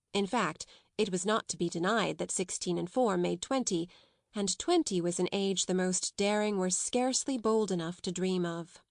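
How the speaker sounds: plain reading voice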